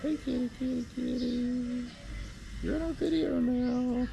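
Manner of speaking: high sing-song voice for a cat; sweet high pet talk to a kitty; calling a cat. Real, not acted.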